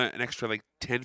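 usual speaking voice

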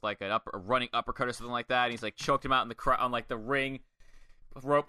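ordinary delivery

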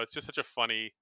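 usual speaking voice

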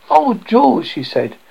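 gasped, high dismay; horrified discovery; shocked. Acted. Wife discovers trick.

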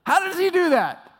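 high, loud astonishment; amazed. Real, not acted. Pastor marvelling to congregation at Brian's gift, high and loud.

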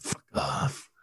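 whispered imitation of a movie line; barely voiced quote; whispered echo. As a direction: whisper it as a mock dismissal.

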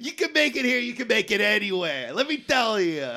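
put-on high-pitched, boastful New York accent; hammed-up New Yorker. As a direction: crow about the city as a cartoon New Yorker. Keeps up the New Yorker bit.

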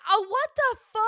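mock disbelief. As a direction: squeal it in mock shock. Mock reaction to absurd comedy bit.

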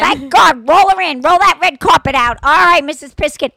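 loud, high gushing greeting; gushing welcome. Retold or imitated. Vet staff welcoming a favorite client, loud and gushing. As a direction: roll out the red carpet with your voice.

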